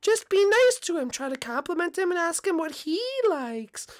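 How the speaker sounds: calm your nervous son down gently; soothing mom voice. Acted.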